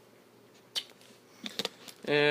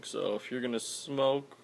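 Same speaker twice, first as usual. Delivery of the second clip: ordinary talk, nothing marked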